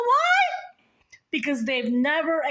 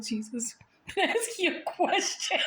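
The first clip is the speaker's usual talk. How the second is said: giggly question; 5 semitones higher, 4 dB quieter than usual. Pretend. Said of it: "I'm like".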